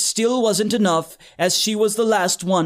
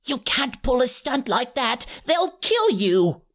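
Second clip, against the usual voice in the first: put-on higher, worried child voice; nervous kid; 4 semitones higher than usual. Seymour, warning his friends about the guards, worried higher voice. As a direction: plead with him not to do it. Timid classmate pleading.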